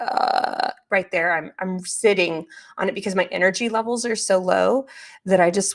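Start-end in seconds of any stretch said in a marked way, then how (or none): 0.0-0.7 drawn-out creaky "uh"; raspy vocal fry. Shown as a demonstration. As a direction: let the voice rasp in fry. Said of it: "There's a lot of rasping".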